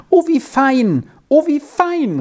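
put-on very high, joyful child's cry; delighted exclamation. A narrator's reading. Girl hears her friend's good news, delighted exclamation. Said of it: "rief Squirrel erfreut aus".

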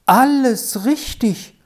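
high-pitched, jubilant delight; greatly satisfied. A narrator's reading. Rolf hears all his riddles solved, highly delighted. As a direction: exult as a long-held wish comes true. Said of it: "sagte Rolf mit großer Befriedigung".

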